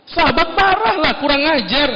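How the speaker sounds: a companion bristling at the insult; mock angry companion. Companion of the Prophet outraged at the Badui.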